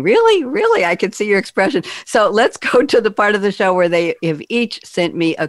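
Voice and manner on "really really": high, disbelieving double take; mock Carla's stunned disbelief; high-pitched incredulous echo; incredulous really. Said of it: "Carla saying".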